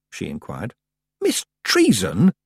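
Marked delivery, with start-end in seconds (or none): 1.2-2.3 slow, high-pitched stern reproach. A narrator's reading. Tiffany catching the witch faking death, stern. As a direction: draw the name out reprovingly. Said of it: "said Tiffany sternly".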